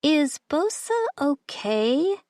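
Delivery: slow, careful question; slowly. Acted. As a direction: ask it slowly, dreading the answer. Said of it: "she asked slowly".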